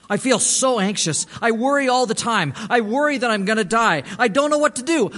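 imitating someone desperately anxious; anguished worry. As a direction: pour out the man's dread in an acted wail. Retelling a distressed man's outpouring.

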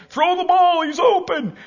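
hammed-up fan yelling at the screen; armchair fan yelling. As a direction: bark advice at the quarterback. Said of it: "you got these fat guys saying".

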